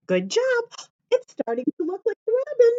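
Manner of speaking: bright, high praise for little ones; high, cheery teacher voice; cheerful praise. Real, not acted. Praising children during a craft.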